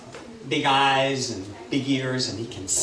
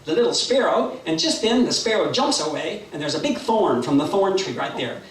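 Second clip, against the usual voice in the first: lively, higher-pitched action narration; animated storytelling; 5 semitones higher, 4 dB louder than usual. A narrator's reading. Storyteller acts out the hawk diving at the sparrow, lively narration. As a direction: build the dive with excited sweep.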